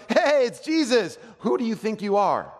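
high, loud mock greeting turning to challenge; hammed-up townsperson calling out; hometown crowd. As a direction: stretch out the hey, then challenge him. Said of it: "and everybody goes".

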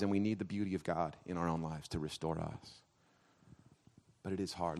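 low, soft, grave preaching; hushed, heartfelt words to a wounded congregation; solemn pastoral appeal. Real, not acted.

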